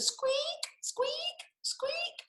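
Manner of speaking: very high whispered squeaks; high, whispered mouse squeak; tiny squeak. Acted. Tiny squeak read aloud.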